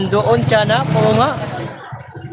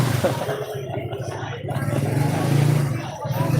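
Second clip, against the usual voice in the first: long breathy laugh; 4 semitones lower, 7 dB quieter than usual. Real, not acted. Laughing at Criselda comment.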